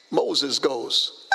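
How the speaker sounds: normal talking voice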